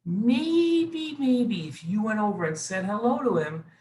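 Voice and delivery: high, gentle coaxing maybe; coaxing suggestion. Acted. Aaron coaxes Reuven to make up, high and gentle. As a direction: gently nudge him toward peace.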